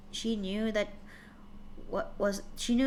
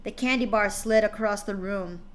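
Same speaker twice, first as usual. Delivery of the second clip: plain reading voice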